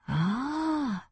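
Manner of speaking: long, soft, high sigh. A narrator's reading. Ohashi grasps her explanation, soft sighing. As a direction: let out a drawn-out sigh of realisation. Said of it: "sighed Ohashi".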